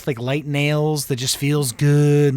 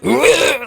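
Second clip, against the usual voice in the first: imitated loud retching noise; loud nasty noise; 6 semitones higher, 5 dB louder than usual. Teller showing the last thing wanted in ASMR, nasty noise. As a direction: make a gross, loud choking noise. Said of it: "like, really loud, nasty noises".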